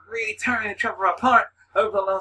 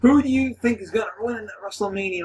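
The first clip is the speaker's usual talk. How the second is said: ordinary talk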